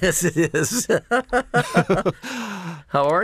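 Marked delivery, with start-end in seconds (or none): 0.0-0.9 real laughing reply, much higher pitch. Real, not acted. Professor greeting an old acquaintance on the phone, laughing. 0.9-2.8 real high-pitched laugh; hearty laugh. Real, not acted. Professor laughing at hearing from an old acquaintance, delighted laugh.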